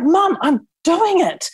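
high, slow mock whine of a boy; imitating son's protest. Son protests while mum yells.

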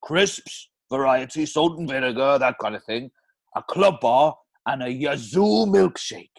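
Plainview read in Welsh; Welsh accent. Acted. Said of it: "that Welsh accent".

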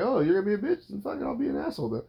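sarcastic retort, pitch rising high; mock snarky comeback. Mimicking himself snapping back at his wife in a fight, mock snark. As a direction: toss the insult right back, mocking.

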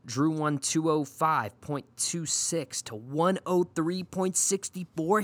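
ordinary talk

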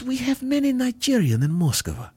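high voice with broken English; Russian host. Retold or imitated. Russian host telling the comedian about Nigerians in Moscow. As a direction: boast it high and proud, foreign-sounding.

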